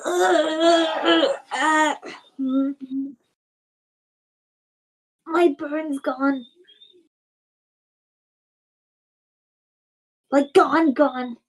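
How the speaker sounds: high, halting voice of stunned disbelief; astonished character voice. Acted. Patient finding his burn gone, high stunned voice.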